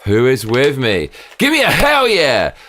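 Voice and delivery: big shouted high rally cry; roaring rallying speech. Acted. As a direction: whip the crowd up, yelling. Show host rallying his audience.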